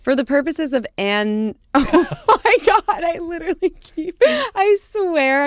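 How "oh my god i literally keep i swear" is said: laughing. Real, not acted. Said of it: "keep a straight face".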